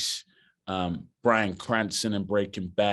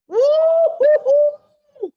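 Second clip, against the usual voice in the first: much higher, louder, stretched whooping cheer; joyful whoop; 11 dB louder, much slower than usual. Real, not acted. Actor cheering in the pool after getting the part, whooping. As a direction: whoop it out in triumph.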